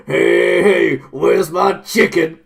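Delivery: put-on loud, slow, slurred drunk call; stumbling drunk yell. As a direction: bellow it like a drunk barging in. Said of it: "stumbled through the door going".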